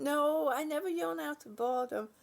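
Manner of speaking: ordinary talk, nothing marked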